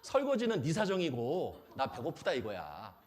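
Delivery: normal talking voice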